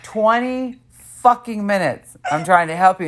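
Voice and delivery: slow, high-pitched exasperated complaint; mock exasperation. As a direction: imitate a fed-up friend, stretching each word. Retelling friend's exasperated complaint.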